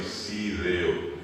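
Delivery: "sideo" slowly, with an S in place of F; stretched "sideo", S for F. Shown as a demonstration. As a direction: say "sideo" like a Guaraní speaker who has no F. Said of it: "les va a decir sideo".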